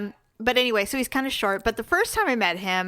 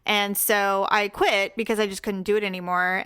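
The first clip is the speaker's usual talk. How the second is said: normal talking voice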